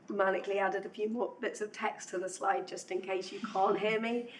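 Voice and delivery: usual speaking voice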